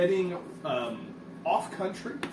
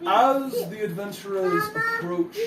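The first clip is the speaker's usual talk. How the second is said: very high, theatrical narration; high pompous declaiming; grand dictation voice; 5 dB louder than usual. Acted. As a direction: dictate his legend with flair. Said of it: "He says".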